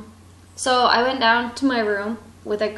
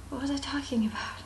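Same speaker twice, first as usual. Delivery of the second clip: whisper; 3 semitones higher, 9 dB quieter, faster than usual. Real, not acted. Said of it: "unvoiced aside".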